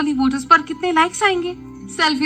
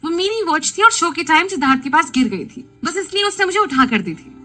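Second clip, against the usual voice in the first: fast, higher, hurried denial; quick defensive reply; 7 semitones higher than usual. A narrator's reading. Aliya quickly denies the watch was a gift, hurried. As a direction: rush the denial out, a touch raised. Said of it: "Alia झट से बोली".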